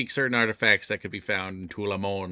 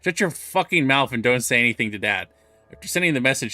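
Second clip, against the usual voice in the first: ordinary talk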